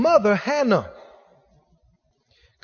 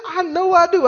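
much higher imitated woman's voice; insistent lady; 8 semitones higher than usual. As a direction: imitate a wife pressing her demand. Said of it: "She said".

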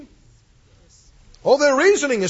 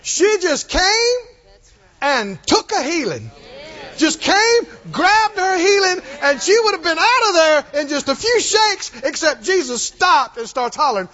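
full-force preaching; 7 semitones higher than usual. Acted. Preacher stirring the congregation, fervent and building. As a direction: drive it home from the pulpit.

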